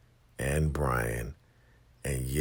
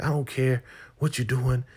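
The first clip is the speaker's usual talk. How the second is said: high, louder frantic demand; mock yelling; 10 semitones higher, 3 dB louder than usual. Lynn yelling at Andrew to free them, mock yell. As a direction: yell at him to get you out now. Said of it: "she starts screaming and yelling".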